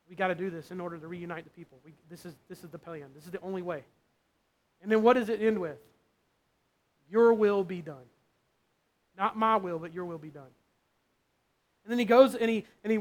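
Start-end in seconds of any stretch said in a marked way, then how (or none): 0.1-3.8 much softer, almost to himself; hushed inner resolve. Retold or imitated. Jesus's inner talk with himself. 7.1-10.5 earnest surrender. Retold or imitated. Jesus surrenders in the garden.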